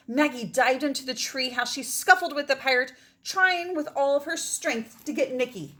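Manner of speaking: put-on expressive read-aloud for children; story narration. Teacher reading a story to her class, expressive read-aloud. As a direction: tell it like a bedtime adventure.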